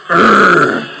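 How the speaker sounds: loud playful lion growl. Shown as a demonstration. Preacher calling himself a lion, loud playful growl. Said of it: "growl this sermon down".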